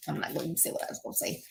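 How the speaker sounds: a quick hushed aside to herself; whispered aside. Real, not acted. Stopping herself, she whispers the aside fast. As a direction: whisper it fast under your breath.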